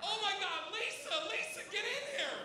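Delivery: high-pitched mock alarm; imitated girl. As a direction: call your friend over as an appalled girl, voice high. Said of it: "you hear one girl just go".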